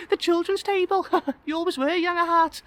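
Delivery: very high, laughing female voice; amused woman's voice. Acted. Old crush teasing guest about his table, high laughing voice. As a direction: tease fondly in a high voice.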